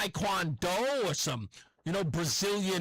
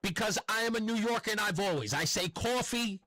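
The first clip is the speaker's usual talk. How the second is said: usual speaking voice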